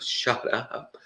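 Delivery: whispered shut up; mock hushed retort. Guest whispering back at the heckler, mock hush. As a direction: hiss it under your breath. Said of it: "he's like".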